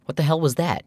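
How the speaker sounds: high, shocked outburst; jolted by what he just saw; startled exclamation. Acted. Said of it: "he exclaimed".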